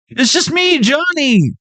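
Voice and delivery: very high mock plea; high-pitched reply to a challenge; acted panicked answer. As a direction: squeal it back, high and hammed-up.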